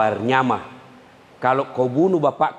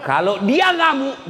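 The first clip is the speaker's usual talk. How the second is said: higher, slow, louder warning; emphatic warning; 12 semitones higher, 3 dB louder than usual. Acted. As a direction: drag it out as a warning. Warning about angry wife.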